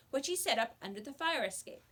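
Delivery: ordinary delivery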